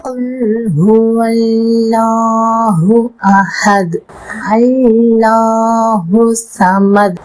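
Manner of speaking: slow, drawn-out Quran chanting; tajweed recitation. Shown as a demonstration. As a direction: stretch every vowel, enunciate each letter. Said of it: "எப்படி தஜ்வீதோட ஓதணும்னு".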